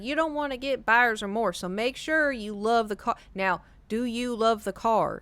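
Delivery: slow, imitated Texas drawl; Texas accent. Mom's boyfriend warning about buyer's remorse, slow Texas accent. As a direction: stretch it out like an advising Texan. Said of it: "very Texas".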